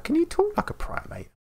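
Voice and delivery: put-on very high little kid voice; child's voice. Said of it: "He was like".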